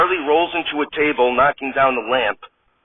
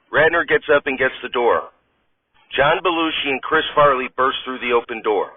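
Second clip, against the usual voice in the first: ordinary talk, nothing marked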